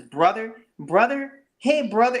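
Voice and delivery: high, lilting mock greeting; mocking soft brother-talker. Mocking anybody who says brother to Mike Tyson, high-pitched imitation. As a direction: pitch it up, mocking a too-friendly guy. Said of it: "anybody who talks like that".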